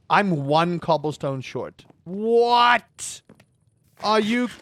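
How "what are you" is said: disbelief. Real, not acted. One cobblestone short, disbelief.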